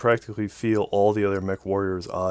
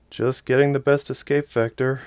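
weakly; 5 semitones higher, 4 dB louder than usual. A narrator's reading. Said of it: "she said weakly".

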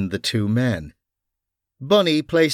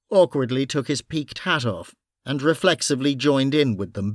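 usual speaking voice

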